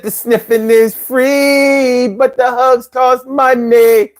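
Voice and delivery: lightly voiced purring cat, slow. The host repeats the cat's line in slow cat voice. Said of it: "I said".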